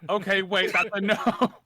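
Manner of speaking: loud, high amused realization; excited. Real, not acted. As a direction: blurt it out, laughing at the answer. Laughing realization at table.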